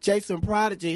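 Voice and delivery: loud, high hype radio host voice; radio host intro. Acted. Host hyping her guest on air, loud DJ voice.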